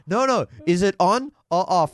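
high, loud retold exasperation; frustrated, imitated. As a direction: imitate an exasperated driver pressing for an answer. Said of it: "getting frustrated".

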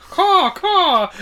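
high squawking caw; shrill screeching bird woman. Retold or imitated. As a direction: screech like a crow. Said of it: "You also said".

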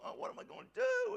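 mock panic. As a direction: squeak out the worry like a panicked person. Mocking worried self.

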